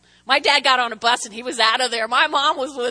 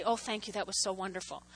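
soft, low, gushing husband; overboard gratitude; 6 semitones lower, 12 dB quieter, faster than usual. Retold or imitated. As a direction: quietly heap thanks on the cook. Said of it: "he got a little overboard".

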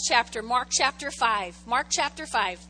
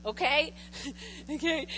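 ordinary delivery

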